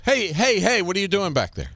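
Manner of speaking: high, sharp call across the room; hammed-up boss catching a slacker; Alec Baldwin. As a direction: challenge the man at the back, pitched high. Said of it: "he goes".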